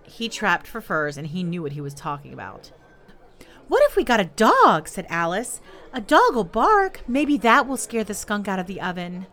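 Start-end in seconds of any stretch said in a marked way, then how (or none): 3.7-9.3 high, loud eager suggestion; eager woman. A narrator's reading. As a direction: pitch the idea brightly. Alice voiced.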